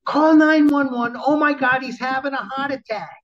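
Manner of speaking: high-pitched hollering for help, imitated; retold scream, starting loud and very high; panicked yell. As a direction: yell it across the room like a frantic wife. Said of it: "and yells in the dealership".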